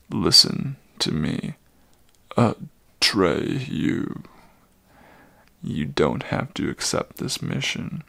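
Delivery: put-on low, calmer old voice; calmer old centaur. Old Chiron releasing Atreyu, low calmer voice. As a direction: reassure the boy, steadier now.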